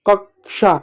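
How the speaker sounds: कक्षा said "kak-sha"; correct ksh cluster. Shown as a demonstration. Teacher reading the word for children, कक्षा as "kak-sha". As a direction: sound the k before sh in कक्षा. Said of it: "इसको पढ़ते हैं".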